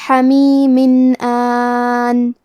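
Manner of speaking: حمیم آن with a plain nun, no nasal hum, long alif; clear tanween. Shown as a demonstration. Teacher demonstrating izhar to students, very slow. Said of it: "تنوین ظاہر کریں گے اور اسے نون ساکن پڑھیں گے".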